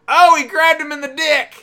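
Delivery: high, very loud outburst; excited shout. Real, not acted. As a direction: yell the play out in gleeful shock. Reacting to a low blow.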